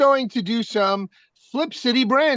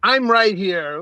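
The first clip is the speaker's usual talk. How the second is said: ordinary talk, nothing marked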